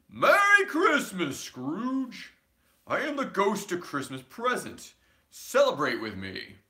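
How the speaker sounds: high, loud, booming cheer; jolly giant voice. A narrator's reading. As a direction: boom out a hearty greeting. Jolly ghost of Christmas present.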